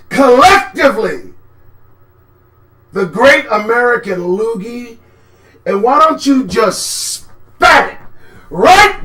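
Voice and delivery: loud, rising furious rant, slowing down; heated shouting rant; angry rant. Real, not acted. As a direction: spit the words out in outrage. Angry rant about medal.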